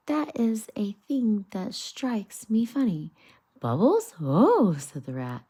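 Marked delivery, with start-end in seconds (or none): 0.1-3.1 high, light small voice for Mole; a timid little animal voice, puzzled. Acted. Mole tells Rat what strikes him funny, high and light. Mole finishes his remark. 3.6-4.7 cheerful. Acted. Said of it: "chippered up cheerfully".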